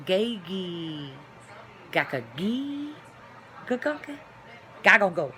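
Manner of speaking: rapid g and k syllables, playful; made-up gibberish talk. Shown as a demonstration. Showing viewers her family's secret talk, playful gibberish. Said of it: "I just talk in this way".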